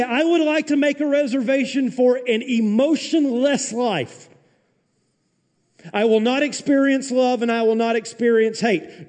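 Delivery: high, loud, mocking voice of a wishful churchgoer; mock request. Pastor mocking the congregation's wish, high and loud. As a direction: ham up the naive request.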